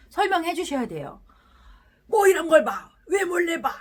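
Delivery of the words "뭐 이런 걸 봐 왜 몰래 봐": loud, higher snapping at the spouse; hammed-up furious shout at being spied on; angry outburst. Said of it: "하면서 막 화내지 마시고요".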